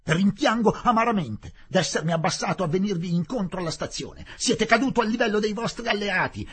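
loud, high contemptuous scolding; bitter tirade. Acted. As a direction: spit out the regret and scorn. Collector's bitter tirade continues.

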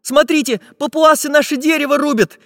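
high-pitched alarmed interruption; frightened cry. A narrator's reading. Chizhik seeing the tree being chopped, frightened. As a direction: cut in with a scared warning. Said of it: "испуганно прервал их Чижик".

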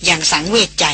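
ordinary delivery, nothing marked

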